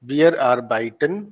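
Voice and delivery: wir arbeiten drawn out in clear syllables; slow model pronunciation. Shown as a demonstration. Teacher models wir arbeiten, slow and deliberate. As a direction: say wir arbeiten very slowly for learners. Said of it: "इसको ऐसे ही बोलना है".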